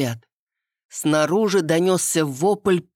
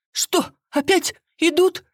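much higher, alarmed; startled alarm; 13 semitones higher than usual. A narrator's reading. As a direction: jump up in fright. Startled woman jumps up.

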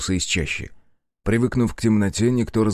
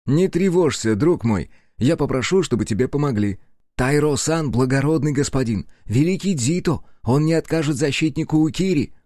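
higher, lively reassurance; encouraging; 5 semitones higher than usual. Acted. Аяшики promises help to worried friend, encouraging. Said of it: "подбадривая".

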